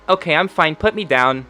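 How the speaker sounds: put-on high-pitched small-child voice; little girl. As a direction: pipe up like a six-year-old wanting down. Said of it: "And then she goes".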